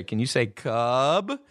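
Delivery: put-on cub spoken slowly and clearly, like teaching a toddler; slow coaxing sound-out of cub; slow, careful prompt. Big brother tests his little brother with a word, slowly. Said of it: "I'd say".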